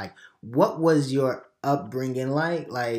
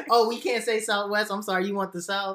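laughing apology; 8 semitones higher than usual. Real, not acted. Teasing apology after laughing.

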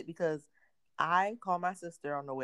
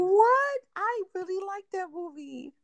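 much higher, surprised protest; 3 dB louder, slower than usual. Real, not acted. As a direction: sound shocked they haven't seen it. Surprised friend hasn't seen it.

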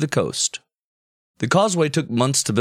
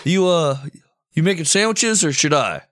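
husband voice; 6 semitones higher than usual. Pretend. Skit as a couple.

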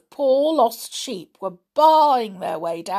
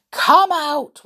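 high, projected echo call; 8 semitones higher, 7 dB louder than usual. A narrator's reading. Echoes repeat Julian's words, high and ringing. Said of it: "whispered the echoes".

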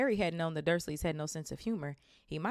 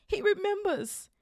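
high thrilled cry; excited cry; 7 dB louder, slower than usual. Acted. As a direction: cry it out with joy. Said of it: "cried Delighted Diggle".

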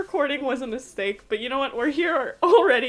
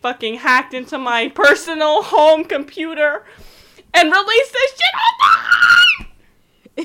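hammed-up author's tantrum ending in a shout; Stephenie Meyer rant; 8 dB louder, slower than usual. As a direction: start firm and slow, then blow up into a yell. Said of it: "Stephenie Meyer was like".

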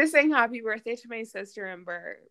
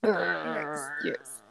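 burping the word; burped word; 6 semitones lower than usual. Real, not acted. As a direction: push the word out on a burp. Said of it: "That's my hidden talent".

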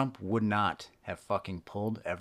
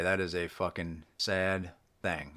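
ordinary talk

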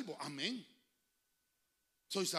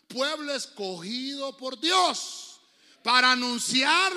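slow, loud, rising proclamation; preaching at full force; 4 semitones higher, 14 dB louder, much slower than usual. Real, not acted. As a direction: build to a ringing climax. Climax of the sermon. (predicación a todo pulmón)

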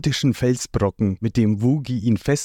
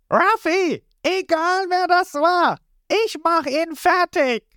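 very high, slow, strained yell; angry shout. A narrator's reading. Lysop swears revenge to Ruffy, high shouted vow. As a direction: shout out your threat. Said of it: "Lysop brüllt los".

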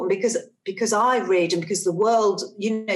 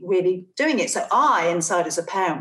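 normal talking voice

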